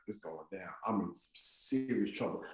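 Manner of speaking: low, very soft aside; worried inner thought. Retold or imitated. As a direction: think it under your breath, dreading trouble. Worried thought while backing away.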